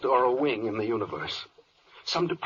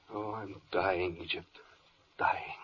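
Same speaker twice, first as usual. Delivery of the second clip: slow, faint, hammed-up death speech; mock dying; 9 semitones lower, 9 dB quieter, slower than usual. Worried official quoting a dying hero, hammed-up fade. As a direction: ham up a fading dying hero. Mock-tragic Shakespeare quote.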